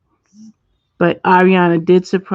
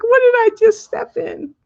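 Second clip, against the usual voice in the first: high, louder mock cat voice; imitating the cat; 3 dB louder than usual. Streamer mimicking her cat with sticky paws, high mock cat voice. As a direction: squeak it out as the puzzled cat. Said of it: "He's like".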